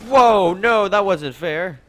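very high and loud, outraged; loud protest. Real, not acted. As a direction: yelp that it wasn't fair. Killed in game, protesting.

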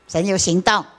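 ordinary talk

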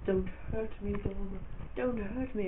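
high, pleading whimper of a cowering enemy; begging for mercy. Retold or imitated. Fleeing guy with the sledgehammer begs Dom, high and pleading. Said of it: "He was like".

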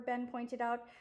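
plain reading voice

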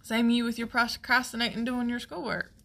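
normal talking voice

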